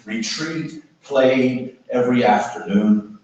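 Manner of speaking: slow, measured spoken-word delivery with surging stresses; poetry reading. Acted. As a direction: declaim the poem, lingering on phrases and pauses. Reads his poem aloud.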